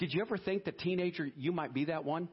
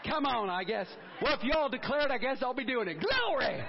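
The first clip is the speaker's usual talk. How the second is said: high, loud, playful church holler; revved-up joking declaration; exuberant shout; 6 semitones higher, 3 dB louder than usual. Pretend. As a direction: build up to a ringing Glory. Jokes with congregation, calls out Glory.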